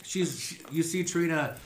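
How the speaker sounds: normal talking voice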